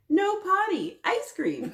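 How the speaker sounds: put-on high, loud toddler voice refusing; little sister. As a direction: play the stubborn toddler sister. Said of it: "what is the little sister saying".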